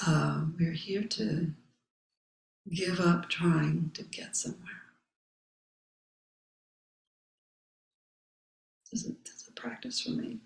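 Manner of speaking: calm mantra. Acted. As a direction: let each line settle like a meditation. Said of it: "my own mantra".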